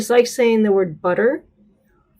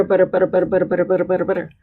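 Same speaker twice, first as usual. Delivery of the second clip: butter repeated fast, flapped t; butter as fast as you can, tongue tapping; fast drill; 3 semitones lower, much faster than usual. Shown as a demonstration. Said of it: "as fast as you possibly can".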